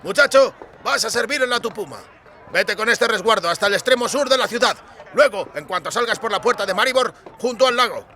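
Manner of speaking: gruff official voice barking orders; brusque clerk. Acted. Recruiting officer dismissing a recruit, brusque. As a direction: hurry the recruits along like a bored clerk.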